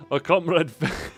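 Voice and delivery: put-on comrade in a Russian accent; mock Russian accent on comrade. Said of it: "saying the word comrade with the Russian accent".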